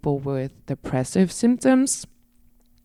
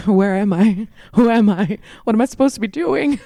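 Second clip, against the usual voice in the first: higher, louder hammed-up panic, half laughing; mock panic; 7 semitones higher, 5 dB louder than usual. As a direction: play lost and frantic on stage, amused at yourself. Acting out blanking on stage.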